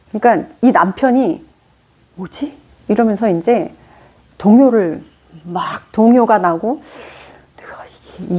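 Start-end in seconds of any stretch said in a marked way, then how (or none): 2.2-2.6 puzzled murmur. Retold or imitated. Husband puzzled, inner thought. 7.6-8.4 softer, whispered self-doubt; inner whisper. Retold or imitated. Husband quietly doubting himself after his wife stays calm, whisper.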